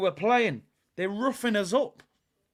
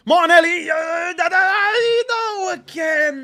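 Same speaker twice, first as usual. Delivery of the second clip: anguished shout; 9 semitones higher, 7 dB louder than usual. Real, not acted. Chance goes begging, reacting live.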